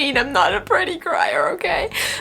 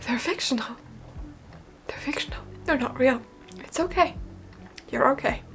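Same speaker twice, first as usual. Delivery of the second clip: much softer self-soothing, half-whispered; calming herself; 10 dB quieter than usual. Real, not acted. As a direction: talk yourself down gently. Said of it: "Calm down".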